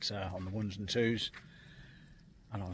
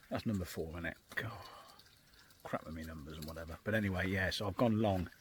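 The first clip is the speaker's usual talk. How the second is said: normal talking voice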